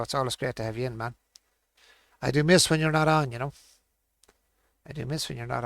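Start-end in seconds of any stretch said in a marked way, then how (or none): 2.2-3.3 loud sincere thanks. Real, not acted. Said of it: "thanking viewer Frodo".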